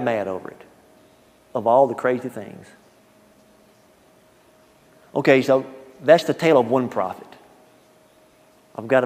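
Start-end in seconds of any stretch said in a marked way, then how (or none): none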